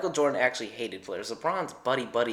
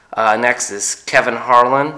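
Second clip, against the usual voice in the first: usual speaking voice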